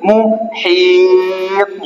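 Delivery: "মুহিত" with a very long "হি" and bounced final stop; qalqalah demo. Shown as a demonstration. Teacher modelling the word for pupils, drawn-out recitation. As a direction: slowly hold the middle of "মুহিত", then spring off the end. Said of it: "এটাও প সাকিন".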